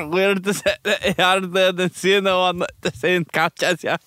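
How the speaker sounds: high, slow put-on Scottish accent. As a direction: garble it in broad, slow Scottish. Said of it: "then you say it in, um, Scottish".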